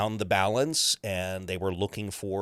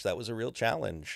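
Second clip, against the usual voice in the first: usual speaking voice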